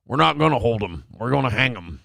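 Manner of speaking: low, stiff-mouthed American drawl; hammed-up backwoods American accent, pitched low; Sling Blade drawl. Actor botching the line for director, low American drawl. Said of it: "this isn't Sling Blade".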